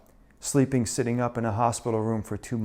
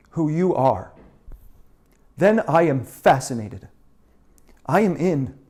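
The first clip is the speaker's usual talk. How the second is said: raised voice, pitched high and insistent; emphatic challenge; 6 semitones higher, 9 dB louder than usual. Acted. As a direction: press each phrase hard, loudly. Intense challenge to open up.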